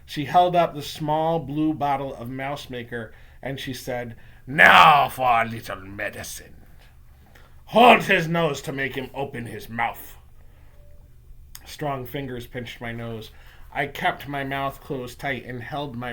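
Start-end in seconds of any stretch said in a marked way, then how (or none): 4.5-10.2 menacing witch giving orders; witch villain. Acted. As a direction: gloat and command like a grand witch. Witch villain orders the boy held.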